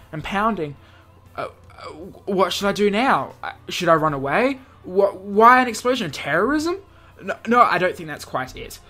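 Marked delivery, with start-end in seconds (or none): none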